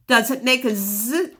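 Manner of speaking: normal talking voice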